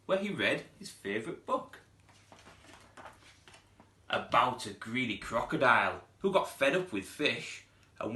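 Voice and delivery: lively read-aloud storytelling voice; storybook narration. A narrator's reading. Teacher reading a picture book to pupils, lively storytelling. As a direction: read it like story time for little ones.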